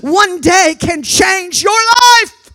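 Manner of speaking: loud, punched phrases building to a shout; fervent preacher's crescendo; full-force preaching. Acted.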